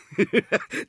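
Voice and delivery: loud wild burst of laughter. Acted. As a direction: cackle wildly. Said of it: "stieß der Bauer ein wildes Gelächter aus". (wildes Gelächter)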